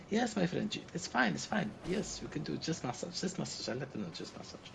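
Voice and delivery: high, sing-song reassurance; reassuring Turkish agent. Retold or imitated. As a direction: soothe the tourists with easy promises. Booking agent reassures them.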